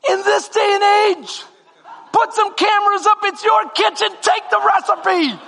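laughing exasperated rant. Retold or imitated. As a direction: tell them to just take the recipe. Ranting advice to manager.

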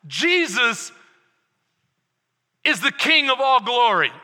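loud, rising sermon voice; preaching at full force. Acted. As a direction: preach it out with fire.

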